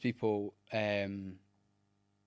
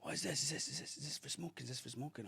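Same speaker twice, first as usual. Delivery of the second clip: hushed, higher attempt at broken Portuguese-accented English; poor Portuguese accent; 4 semitones higher, 8 dB quieter than usual. Portuguese stranger asking about the arm sensor, poorly imitated accent. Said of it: "really poorly trying to give his accent back".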